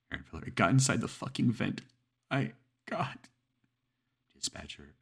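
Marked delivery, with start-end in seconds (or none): 0.4-3.3 higher, louder horrified outburst. Acted. Caller telling the dispatcher it's in the vent, horrified. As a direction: recoil in disbelief.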